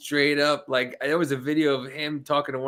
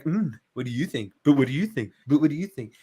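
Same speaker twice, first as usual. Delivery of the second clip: smug, pompous mimicry; 2 semitones lower, faster than usual. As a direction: lightly imitate a pompous man needling with questions. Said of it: "he's a smug, pompous ass".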